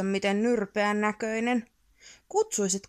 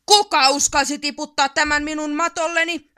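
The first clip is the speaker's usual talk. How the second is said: higher, louder furious troll demand; angry troll growl; 5 semitones higher, 7 dB louder than usual. A narrator's reading. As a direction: bark at whoever dared. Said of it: "ärisi Ärmätti". (vihainen peikko ärisee kovaa)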